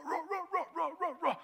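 high yappy barking noises, roar roar roar; imitated dog barking. Preacher imitating the chihuahuas barking, high and yappy.

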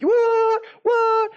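very high, loud falsetto squeals; hammed-up disbelief, stretched out and squealed; mock astonishment. Acting out amazement at the fire.

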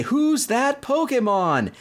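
high, slow TV announcer; dramatic quiz host voice; announcer call. Retold or imitated. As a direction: boom it out like the show's quiz announcer. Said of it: "they'd say".

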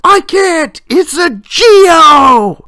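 high, drawn-out mock wail; Ned Flanders. Husband protesting to his wife in the car, high mock yell. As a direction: cry back in exasperation, stretching the last words. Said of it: "he turns and looks at her and goes".

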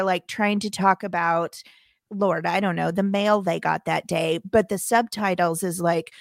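normal talking voice

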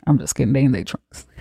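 ordinary talk

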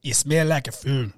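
attempted Irish accent. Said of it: "That's how you sound".

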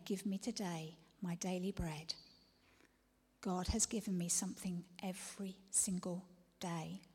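soft, hushed praying; whispered prayer. Acted. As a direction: pray it under your breath.

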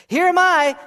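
high, loud eager volunteer; bold, ringing declaration; eager response. Acted. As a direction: call out readily, pitched high and loud. Repeats Isaiah's eager response.

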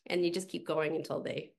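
ordinary talk, nothing marked

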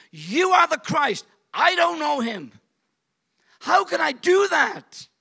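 high, loud, slow lament; desperate wailing regret; anguished Peter. Acted. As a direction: cry out in despair.

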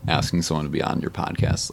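ordinary delivery

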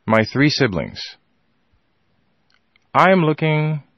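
measured delivery with very long pauses; calm, collected. Retold or imitated. Father to mother about the children, calm and deliberate. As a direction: imitate a father's unflinching composure, phrase by phrase. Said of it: "with a calm, cool collection".

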